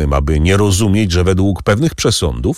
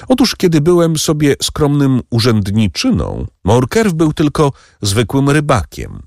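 higher, sneering recollection; scornful Danglars; 7 semitones higher than usual. A narrator's reading. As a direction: belittle the fisherman's past. Danglars recalls Morkerf's past. (wyżej, pogardliwie o przeszłości rybaka)

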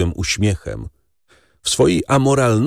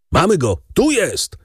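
high-pitched triumphant call; shouted alert; 9 semitones higher than usual. A narrator's reading. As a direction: call out that the fugitive is found. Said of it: "krzyknął głos za drzwiami". (wysoki okrzyk zza drzwi)

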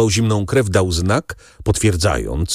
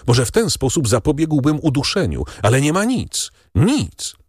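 helpless desperation; 7 semitones higher than usual. A narrator's reading. Doctor finding nothing to save the choking patient, desperate. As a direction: pitch climbing in mounting desperation.

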